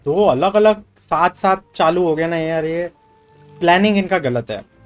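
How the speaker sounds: ordinary delivery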